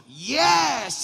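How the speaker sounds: loud, high, stretched exclamation; eager shout. Retold or imitated. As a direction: belt it out in hammed-up eagerness. Acts out clueless eager reply. (seruan lantang penuh semangat)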